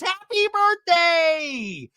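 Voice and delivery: high, loud, drawn-out mock shout; Heather's birthday scream. Heather greeting Shannon for her birthday, long high shout. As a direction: stretch it into a piercing imitated cheer. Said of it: "and Heather goes".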